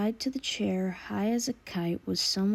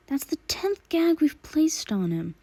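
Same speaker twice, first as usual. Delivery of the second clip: higher, louder frustrated thug; exasperated thug yell; 8 semitones higher, 5 dB louder than usual. Acted. A thug inside the warehouse complaining loudly about the gags.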